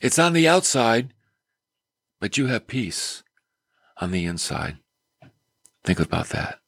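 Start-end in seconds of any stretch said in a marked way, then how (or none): 2.2-6.6 softly reassuring. Real, not acted. Peace inside amid storm.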